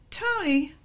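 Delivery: high call of "Tommy"; a mother's high call of her child's name; another mother's tone. Shown as a demonstration. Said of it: "Also warning. Or she says".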